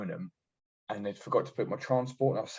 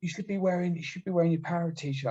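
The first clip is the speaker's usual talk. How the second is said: higher, put-on voice of a nagging officer; mocking the RSM; 9 semitones higher than usual. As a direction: pitch it up to mock her. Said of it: "And she was like".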